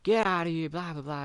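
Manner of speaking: yelling at the bad guys to get out; retold yell. Neighbor yelling at noisy car, mock shout. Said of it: "he's yelling at the bad guys".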